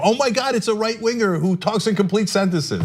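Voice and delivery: high, breathless fake adoration; mock gushing rave. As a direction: gush like a starstruck critic, way up high. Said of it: "can't stop raving about".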